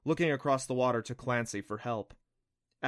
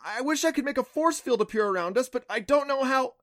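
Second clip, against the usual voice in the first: high, raised, panicky voice; frantic wishing; 12 semitones higher, 5 dB louder than usual. A narrator's reading. Clancy wishing for a force field, frantic. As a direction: plead helplessly in a high voice. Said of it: "Clancy said frantically".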